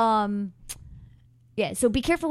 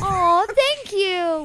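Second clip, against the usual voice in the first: touched aw; 12 semitones higher, 7 dB louder, much slower than usual. Real, not acted. Touched by a compliment.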